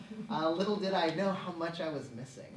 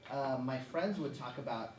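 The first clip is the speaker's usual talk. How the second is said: usual speaking voice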